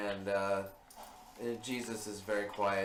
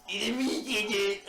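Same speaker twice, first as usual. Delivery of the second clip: mock Jesus voice; 9 semitones higher, slower than usual. Mocking Robin Williams Jesus line.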